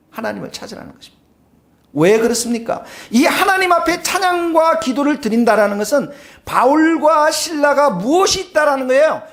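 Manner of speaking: usual speaking voice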